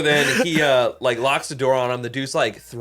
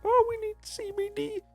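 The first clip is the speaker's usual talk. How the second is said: much higher, put-on little old lady voice; elderly customer voice; 7 dB quieter than usual. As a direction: imitate a sweet elderly shopper asking. Elderly couple quoted at store.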